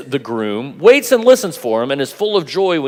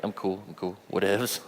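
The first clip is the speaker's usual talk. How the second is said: faking nonchalance; 5 semitones lower, 12 dB quieter than usual. Retold or imitated. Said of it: "It's like".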